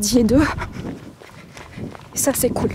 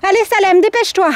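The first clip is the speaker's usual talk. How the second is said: high, loud call to a dog; 9 semitones higher, 7 dB louder than usual. Real, not acted. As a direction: shout to hurry the dog along. Calling the dog to hurry.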